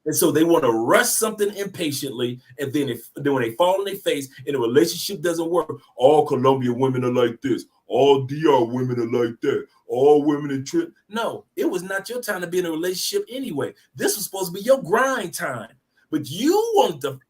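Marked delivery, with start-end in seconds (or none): 5.9-10.9 mock sweeping complaint, pitched down; mock blaming. Failed suitor blames every woman abroad, mock complaint. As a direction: imitate a sore man's lumped-together grumble. Mimics men blaming whole countries.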